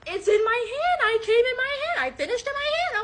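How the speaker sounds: high, softening mock whine; insistent man imitated. Man insisting to her about his hand, high mock whine.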